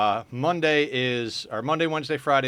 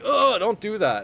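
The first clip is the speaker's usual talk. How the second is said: alarmed friend; 10 semitones higher, 5 dB louder than usual. Retold or imitated. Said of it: "somebody's like".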